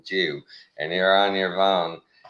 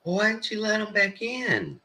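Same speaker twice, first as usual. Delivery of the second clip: much higher nagging wife voice; imitating wife; 3 dB quieter than usual. Husband mimicking his wife about the dogs, high fussy voice. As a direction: mimic her fussy question. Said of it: "she's like".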